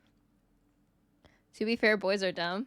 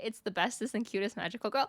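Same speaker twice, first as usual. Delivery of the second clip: ordinary talk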